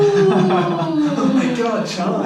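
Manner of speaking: drawn-out shocked gasp; high, loud, stretched out in disbelief. Real, not acted. Friend's shock at a 1/10 score.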